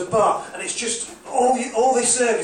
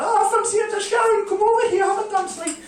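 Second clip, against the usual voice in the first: high-pitched woman calling her friend; Geordie woman; 13 semitones higher than usual. Retold or imitated. Geordie woman calls Sharon over for a dance, high-voiced. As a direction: squeal her friend Sharon onto the floor.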